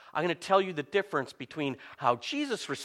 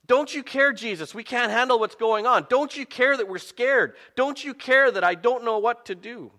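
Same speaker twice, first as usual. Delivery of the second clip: mildly desperate plea, pitched higher; pleading; 7 semitones higher than usual. Acted. Scared disciples begging Jesus for help, pleading. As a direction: act out a distressed appeal, voice raised in pitch.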